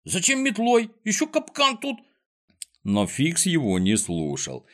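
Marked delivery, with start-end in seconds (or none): 0.0-2.0 high-pitched indignant protest; Незнайка, indignant. A narrator's reading. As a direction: protest in outrage, voice climbing high. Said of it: "возмутился Незнайка". (возмущённо, высоким голосом)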